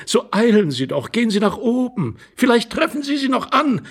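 high, urgent lady, climbing in pitch; urging lady. Acted. Lady sending the intruder upstairs, urgent. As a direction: hurry him along eagerly.

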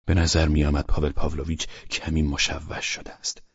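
plain reading voice

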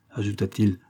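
ordinary delivery

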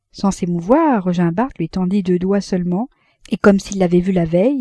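ordinary delivery

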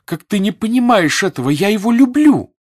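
swelling plea that peaks on the last word; impassioned outburst. A narrator's reading. Natasha's heated declaration of love.

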